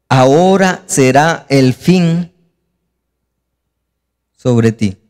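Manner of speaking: slow, weighty pronouncement; solemn verse reading. Acted. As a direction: read it out slowly, with long pauses. Reads God's verdict from scripture.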